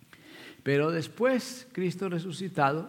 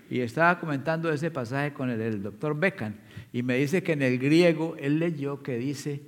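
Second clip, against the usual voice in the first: ordinary delivery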